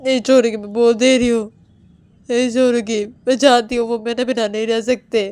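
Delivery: loud, fierce warning to the family; an enraged wife warning everyone; furious threat. Acted. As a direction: threaten them, nearly shouting. Woman threatens family over Rahul.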